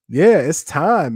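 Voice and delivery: ordinary talk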